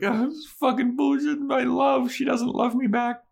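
high, fast, hammed-up whiny lament; mock whiny sob. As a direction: whine it out like a heartbroken fop. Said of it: "He's like".

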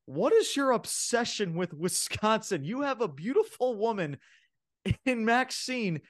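incredulous, high-pitched mock rant; Corey Graves. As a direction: act out an exasperated commentator scolding his partner. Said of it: "Corey Graves is like".

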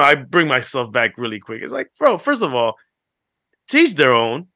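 ordinary talk

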